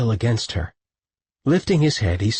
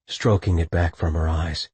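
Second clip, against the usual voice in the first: ordinary delivery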